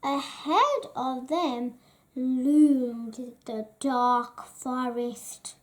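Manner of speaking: usual speaking voice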